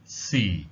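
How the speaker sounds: "si" falling low like a light sigh, Cantonese tone four. Shown as a demonstration. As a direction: sigh "si" downward. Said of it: "as if you were sighing".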